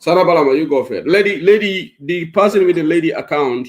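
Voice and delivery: loud call to the next caller. Real, not acted. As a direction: raise the voice to pull a caller in. Host calls out to callers.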